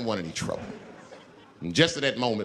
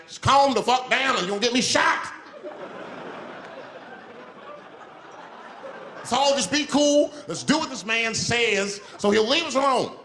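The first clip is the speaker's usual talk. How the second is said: high, raised plea for calm; urgent pleading; 8 semitones higher than usual. Acted. Passenger afraid of getting shot, high urgent plea. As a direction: plead urgently with a panicky crowd.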